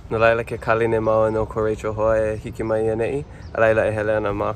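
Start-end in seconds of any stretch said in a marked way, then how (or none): none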